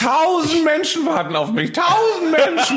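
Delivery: high, drawn-out wailing rant; hammed-up choleric complainer; mocking choleric Böhmermann. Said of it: "der die ganze Zeit sagt".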